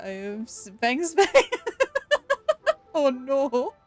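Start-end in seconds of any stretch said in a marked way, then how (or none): 1.3-2.9 real laugh, very high and loud. Real, not acted. Streamer cracks up reading chat, loud high laugh. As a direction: burst into high-pitched laughter.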